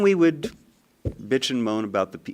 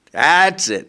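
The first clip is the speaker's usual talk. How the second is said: "That's it." higher and much louder, final; exasperated outburst; 7 semitones higher, 9 dB louder than usual. Retold or imitated. Desperate father gives up.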